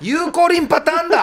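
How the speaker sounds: high-pitched excited exclamation; excited breakthrough. Real, not acted. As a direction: blurt out the sudden realization. Sudden breakthrough in the puzzle.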